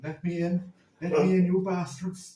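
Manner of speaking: loud mock shout from outside the window; retold drunken shouting. Drunk relatives shouting at the B and B window, mock yell. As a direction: bellow it like a drunk locked out. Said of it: "angry Irish voices at your window shouting".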